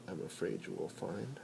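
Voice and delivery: usual speaking voice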